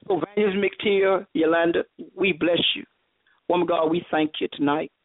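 low, level prayerful thanks; closing blessing. Real, not acted. Preacher thanking her hosts for having her, prayerful blessing. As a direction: settle into a subdued benediction.

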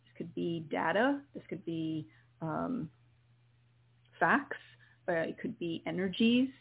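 gently guide, calm and steady; guided meditation. Acted. Continues the healing meditation.

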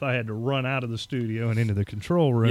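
normal talking voice

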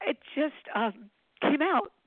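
fighting back tears while talking; real emotion breaking through; choked up. Real, not acted. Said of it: "I could cry when I say it".

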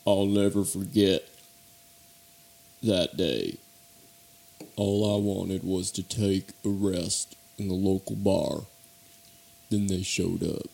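deep dramatic story narration; noir narrator voice. Pretend. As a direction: start a dramatic Western tale low and deliberate. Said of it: "It's called The Fabulous Gang".

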